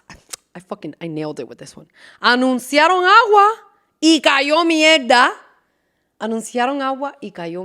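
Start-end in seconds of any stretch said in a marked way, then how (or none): 2.2-5.3 loud, high excited saying. Shown as a demonstration. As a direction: announce it with gusto. Excited first take.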